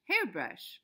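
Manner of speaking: ordinary delivery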